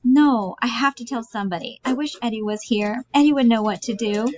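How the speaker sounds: high, urgent aunt voice; anxious high-pitched confession; distressed Bella. Acted. Plays Bella, needing to confide.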